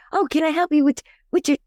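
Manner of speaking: high, sing-song offer of help; eager helpful stranger. Retold or imitated. Coach imitating strangers offering help at the grocery store, high and eager. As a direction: play the overly helpful shopper. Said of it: "were like".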